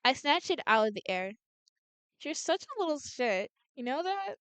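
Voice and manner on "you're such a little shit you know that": teasing character voice. Acted.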